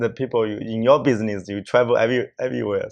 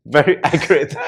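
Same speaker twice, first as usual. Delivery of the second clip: laughing; 7 semitones higher, 5 dB louder than usual. Real, not acted. Laughing about Omicron.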